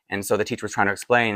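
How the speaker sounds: ordinary talk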